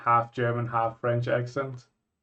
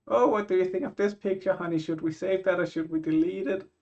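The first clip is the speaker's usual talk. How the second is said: higher mock wife voice; imitating his wife; 8 semitones higher than usual. Husband imitating his wife sorting holiday photos, higher mock voice. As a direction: play the eager wife asking about photos. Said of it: "and be like".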